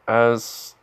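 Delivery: ordinary delivery, nothing marked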